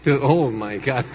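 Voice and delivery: low, slow gringo translator struggling in Spanish; halting mock American accent, pitched low; bad American-accented Spanish. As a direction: imitate an American fumbling for Spanish words. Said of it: "el traductor ni siquiera hablaba bien español".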